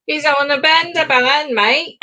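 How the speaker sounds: put-on jokey Cockney accent. Co-host joking about boozed-up Santa, in mock Cockney. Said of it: "I don't know why she's Cockney".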